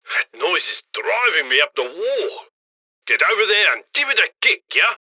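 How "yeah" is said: ordinary talk, nothing marked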